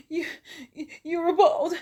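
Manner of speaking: high, loud gasping imitation; acted breathless fright; panicked, breathing hard. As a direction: stammer through mock terrified gasps. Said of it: "she's breathing hard, she's panicking, she's scared".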